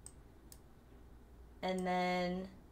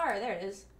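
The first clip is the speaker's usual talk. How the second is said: usual speaking voice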